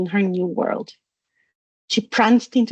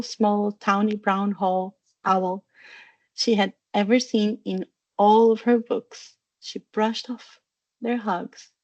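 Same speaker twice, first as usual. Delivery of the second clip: ordinary delivery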